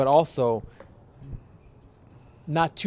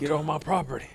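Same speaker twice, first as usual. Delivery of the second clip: put-on grumpy old man; 4 semitones higher than usual. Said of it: "Except without the southern accent".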